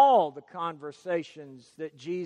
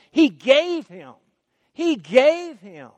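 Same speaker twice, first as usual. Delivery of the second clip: "He gave him" louder and a little higher, repeated; stress on gave; 4 semitones higher, 4 dB louder than usual. Shown as a demonstration. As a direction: drive home "gave" again. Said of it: "God didn't just".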